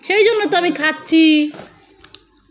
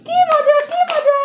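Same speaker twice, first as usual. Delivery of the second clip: put-on much higher, a small child begging for the balloon; toddler voice; 13 semitones higher, slower than usual. Mother voicing her toddler asking for the balloon, high childlike plea. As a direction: plead like a little kid asking mama.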